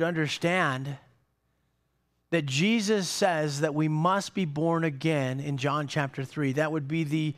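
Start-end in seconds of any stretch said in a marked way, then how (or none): none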